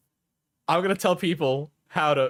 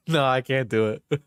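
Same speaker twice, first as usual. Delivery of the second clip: ordinary talk, nothing marked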